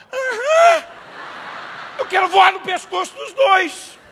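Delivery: very high, loud indignant yell; hammed-up fury; outraged shout. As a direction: explode with comic outrage. Furious at fake healing.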